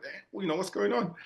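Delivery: rising, high disbelieving quote; mock bafflement. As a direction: act baffled by yet another villain. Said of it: "and it's like".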